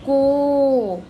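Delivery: put-on drawn-out, sung-out syllable for children; syllable read out for pupils. Shown as a demonstration. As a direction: model the sound को clearly for the class. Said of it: "का पर ओ की मात्रा".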